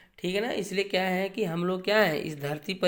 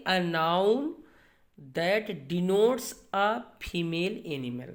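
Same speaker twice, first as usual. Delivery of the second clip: slow dictation; much slower than usual. Shown as a demonstration. Reading the definition out slowly.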